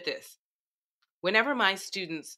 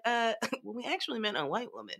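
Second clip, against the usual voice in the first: softer, laughing mock correction; awkward backtrack; 3 semitones higher, 6 dB quieter than usual. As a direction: sheepishly hedge it. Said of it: "And they were like".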